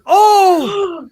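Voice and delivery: high, loud thrilled gasp; excited gasp. Real, not acted. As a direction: gasp with delight. GM reacts to Fireball.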